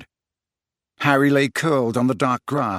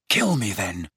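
low, breathless challenge; panting; 5 semitones lower, 3 dB quieter than usual. A narrator's reading. Harry dares Snape to kill him, panting. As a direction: pant out the dare. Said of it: "panted Harry".

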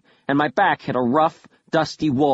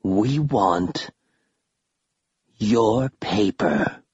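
slow, lightly voiced undead; slow creature voice; 3 semitones lower, much slower than usual. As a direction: drag out words like a ghoul. Undead curator speaking.

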